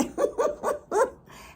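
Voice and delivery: high giggle; delighted laugh. Real, not acted. Owner amused by the baby animals, high giggle.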